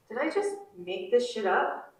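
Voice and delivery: usual speaking voice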